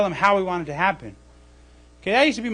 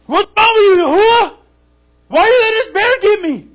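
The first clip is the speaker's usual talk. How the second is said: much higher and louder, indignant; complaining to God; 12 semitones higher, 9 dB louder than usual. Pretend. Believer charged by a bear, yelling at Yahuwah: indignant high complaint. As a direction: plead as if the bear is on you. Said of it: "You'd be like".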